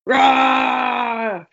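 short shouted werewolf roar; rage roar. Pretend. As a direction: let out a mock rage roar. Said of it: "it's my time to rage".

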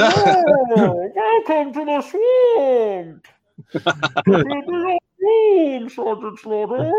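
high, drawn-out, theatrical masked-villain voice; Bane. Acted. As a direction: stretch every word in a lofty, hammed-up villain boom. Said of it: "make me sound like Christopher Nolan's Bane".

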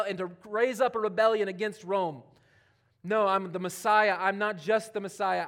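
loud, high, declaring preacher voice; preaching at full force. Acted. Preacher voicing Jesus to the congregation, loud and declaring.

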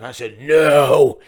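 drawn-out, very loud refusal; demonic. Acted. Woman refusing to hand her purse, demonic bellow. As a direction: blast the "no", long and forceful. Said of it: "she went demonic".

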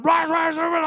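high, loud garbled rant; mock yelling. Driver out the window at cyclist, mock yelling. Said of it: "I'm just yelling at him".

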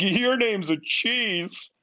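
high, very drawn-out goofy exclamation; mock-delighted high blurt; awkward blurt. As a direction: stretch it out high, like a dim discovery. Blurts dumb remark to woman Bree.

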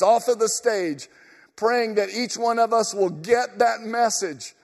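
ordinary talk, nothing marked

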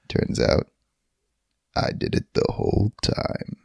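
breathy hushed reveal; whispered twist. Acted.

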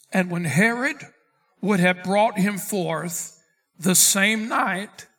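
loud, drawn-out Bible reading; emphatic scripture reading. Acted. Preacher reading the Bible to his class, loud and emphatic. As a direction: read scripture out forcefully to the room. Reads scripture aloud to congregation.

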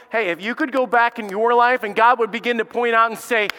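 ordinary delivery

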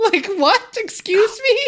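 high-pitched, incredulous outburst; mock disbelief. Podcaster baffled by the name Oliver Leak, mock disbelief. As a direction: react as if you can't believe that name.

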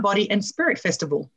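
ordinary talk

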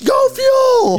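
very high, loud crowd cheer; mock cheer. Spectator switching to cheer the wrestler, excited yell. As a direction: yell support from the stands. Retelling own cheer at match.

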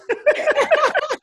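loud, high-pitched burst of laughter; big laugh. Real, not acted. Guest cracking up after his horse joke, laughing loudly. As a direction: burst out laughing at your own punchline.

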